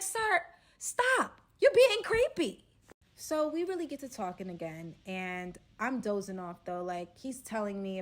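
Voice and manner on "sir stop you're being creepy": creeped-out scolding. Retold or imitated. Scolding the staring robber.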